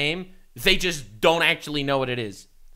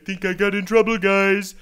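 louder mock repeat of the clip; mocking imitation; 3 semitones higher, 5 dB louder than usual. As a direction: parrot his line mockingly. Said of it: "when he said police, I thought".

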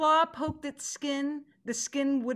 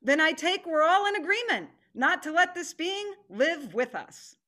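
higher, louder verdict; forceful declaration; 6 semitones higher, 4 dB louder than usual. Acted. Tiger declares the council's verdict on man, forceful and raised. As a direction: press the council to agree.

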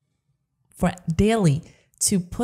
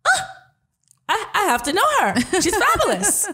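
excited gushing; 11 semitones higher than usual. Retold or imitated. Host gushing on first meeting guest.

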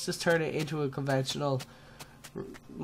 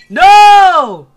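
loud, high, drawn-out wail; anguished shout; 20 dB louder than usual. Real, not acted. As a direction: cry out in dismay. Dismay as the plan fails.

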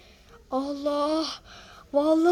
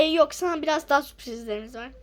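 normal talking voice

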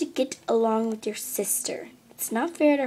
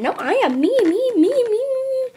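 rising, louder and higher; excited clamoring; 11 semitones higher, 8 dB louder than usual. Acted. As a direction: cry me me me, higher each time. Fans compete for best friend.

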